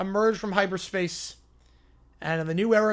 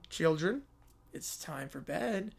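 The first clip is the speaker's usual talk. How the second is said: put-on lower, softer, slow old woman voice; creepy old lady; 3 semitones lower, 8 dB quieter, slower than usual. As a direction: call them to bed like a creepy old mother. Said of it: "who's the old lady".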